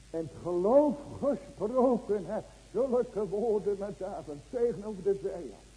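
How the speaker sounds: loud, driving sermon voice; preaching at full force to the church; fervent preaching. Acted. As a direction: hammer each question home to the congregation. Exhorting the congregation.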